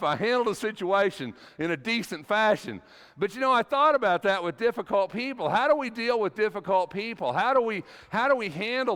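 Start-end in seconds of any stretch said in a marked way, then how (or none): none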